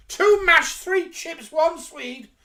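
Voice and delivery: very high, loud cafe holler; shouted order. Acted. Waitress calling orders in the cafe sketch, shouting. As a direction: bellow the food order in a shrill shriek.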